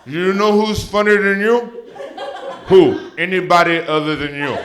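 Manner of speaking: high, loud mom voice mocking her son; drunk mom taunting. Mom taunting her comedian son, high and loud. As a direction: needle him like a tipsy mother. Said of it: "you heard me".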